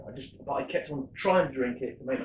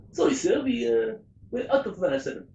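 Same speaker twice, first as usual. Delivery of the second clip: put-on lightly done French barman accent, pitched higher; an amateur's French accent for the barman; 10 semitones higher than usual. Barman apologising to a skier, mock French accent. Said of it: "excuse my accents".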